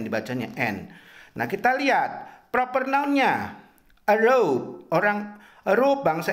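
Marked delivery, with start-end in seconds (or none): 0.6-0.9 N said as the letter name en, not the written an. Shown as a demonstration. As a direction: read the suffix aloud as en. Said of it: "tulisannya A dan N".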